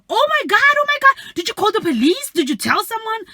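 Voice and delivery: high, loud alarmed questions; shocked gasping. Retold or imitated. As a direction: react in horror to a friend's story. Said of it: "So we were all like".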